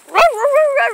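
high, loud playful barking; dog bark imitation. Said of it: "I heard Chloe barking".

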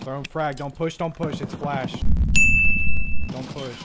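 shouting callouts in a firefight; urgent shout. Real, not acted. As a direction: yell it out, urgent. Firefight, calling out to teammate.

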